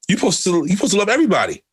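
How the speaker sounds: high, sarcastic parroting of the preachy friends; mocking friends. Mocking friends who judge him.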